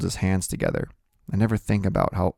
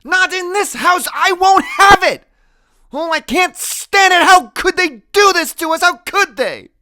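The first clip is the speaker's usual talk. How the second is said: very high, full-voiced shout; anguished yelling; 11 dB louder than usual. A narrator's reading. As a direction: cry out in protest. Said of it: "Graham yells".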